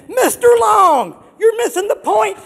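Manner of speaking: put-on shouted, scolding old customer; imitated shout. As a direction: play the customer yelling to make her point. Old lady customer scolds him.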